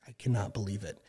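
under-the-breath disbelief, whispered; Adam whispering his disbelief about the phone, hushed; retold whisper. Adam muttering, worked up, retold.

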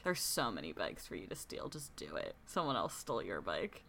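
a low conspiratorial mutter to the screen; muttered under breath. Retold or imitated. To the film's hero, urging him to steal a bike, in a soft muttered aside.

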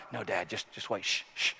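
quiet, urgent hushing that fades to a whisper; hushing kid. Retold or imitated. A boy shushing his dad at the game, soft and unvoiced at the end. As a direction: shush dad so nothing is missed.

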